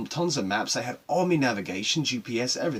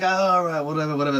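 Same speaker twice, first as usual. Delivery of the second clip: dismissive friends; 5 semitones higher, 8 dB louder, faster than usual. Retold or imitated. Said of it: "some were like".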